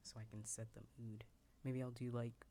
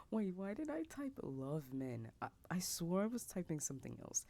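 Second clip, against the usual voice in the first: startled, higher and louder, breaking the whisper; surprised; 6 semitones higher, 6 dB louder than usual. Real, not acted. Noticing she typed the wrong word, suddenly surprised aloud. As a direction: caught off guard by her own typo. Surprised at her own typo.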